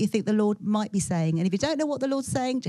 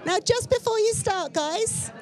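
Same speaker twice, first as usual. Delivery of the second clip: raised, higher-pitched voice over the chatter; calling out; 8 semitones higher than usual. Real, not acted. Organiser rounding up groups of four, calling out. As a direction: call across a busy room to get attention.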